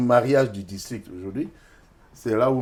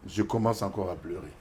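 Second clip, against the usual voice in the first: ordinary delivery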